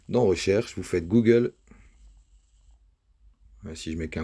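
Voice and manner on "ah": ordinary talk, nothing marked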